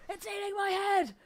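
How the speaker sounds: high, loud hammed-up shriek of horror; mock panic. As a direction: scream it as if being eaten. Pretend horror, repeated.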